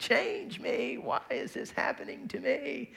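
very high, slow whiny wail; mock screaming baby. Preacher playing himself as a baby complaining to God, high mock wail. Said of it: "I'm more like the screaming baby".

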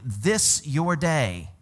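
This your day, each word spaced; slow stressed quote; very slow, deliberate. Retold or imitated. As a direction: draw out This your day slowly. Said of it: "And he's saying".

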